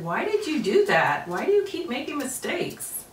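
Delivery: voicing a critical friend; scolding, rising high at the end; Nala's scolding voice. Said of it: "Nala said".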